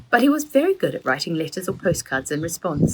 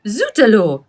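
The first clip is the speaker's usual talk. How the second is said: a loud, high French cry; shouted; 7 semitones higher, 9 dB louder than usual. A narrator's reading. Mother catching the children at the chocolate, shouting. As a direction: burst out in shock, high and loud. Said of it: "She shouted".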